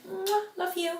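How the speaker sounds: high, soft, cutesy love-you; playful little sing-out after a smooch; affectionate. Retold or imitated. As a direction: blow a kiss and coo it sweetly. Retold affectionate goodbye with kiss.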